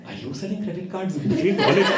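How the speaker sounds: hammed-up indignant question; exasperated dad. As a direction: demand an answer in comic disbelief. Dad exasperated at supposed telemarketer.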